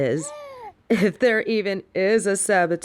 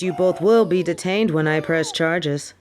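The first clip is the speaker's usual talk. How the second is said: normal talking voice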